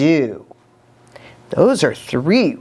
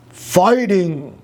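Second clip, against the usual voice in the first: barked. Acted. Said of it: "barked Pushkin".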